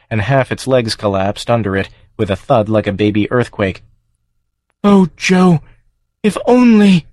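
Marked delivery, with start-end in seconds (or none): 4.8-7.1 high, louder creature cry of regret; longing farm creature. Acted. The dying farm calling Joe by name, high regretful creature. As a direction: ache for him. Farm's last longing words.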